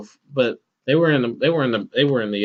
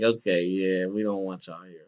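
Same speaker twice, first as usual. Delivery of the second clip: lower, much softer, thinking it to himself; inner voice; 5 semitones lower, 7 dB quieter than usual. Retold or imitated. Boss's private thought about the workers, softer and lower. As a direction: say it under your breath. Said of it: "in my head, I'm like".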